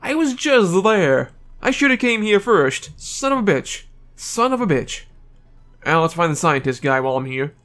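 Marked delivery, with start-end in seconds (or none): 0.0-5.0 high, drawn-out exasperated groaning of the words. Real, not acted. Player cursing at having missed the place, exasperated. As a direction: stretch it out in high-pitched frustration.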